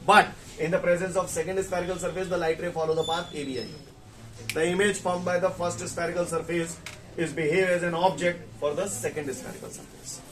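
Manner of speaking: brisk run-through, phrases joined up; quick reread. Shown as a demonstration. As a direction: rattle it off as a quick recap. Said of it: "मैं दोबारा बोल रहा हूं फटाफट".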